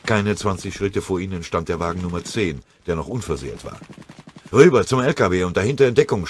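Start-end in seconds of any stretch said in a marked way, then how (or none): 4.5-6.3 laut, höher und schnell gebrüllt; schreiend. Acted. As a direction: treib sie schreiend in Deckung. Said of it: "schrie Oberfeldwebel Fischer". (schneller, lauter Kommandoschrei)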